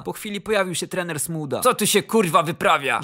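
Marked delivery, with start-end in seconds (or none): 1.6-3.0 loud, curt coach's demand; blunt demand. A narrator's reading. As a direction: throw the question out at once, loudly. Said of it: "rzucił od razu". (głośne, ostre pytanie trenera)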